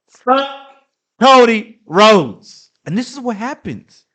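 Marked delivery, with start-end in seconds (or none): 0.1-2.7 loud, slow, drawn-out repeat of the curse; angry repeat. Real, not acted. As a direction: hammer each word out slowly.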